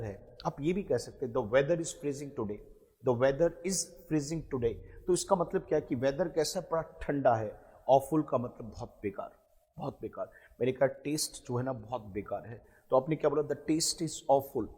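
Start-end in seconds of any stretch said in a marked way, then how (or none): 1.3-4.7 slow dictation. Shown as a demonstration. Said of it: "teacher models sentence for practice". 13.4-14.6 slow dictation. Shown as a demonstration. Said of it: "teacher models sentence for practice".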